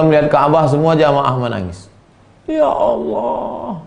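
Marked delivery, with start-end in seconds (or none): 2.5-3.8 high, very slow "Ya Allah"; longing cry. Acted. Pilgrim before the Kaaba calls on God, high and drawn out. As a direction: stretch it out in yearning.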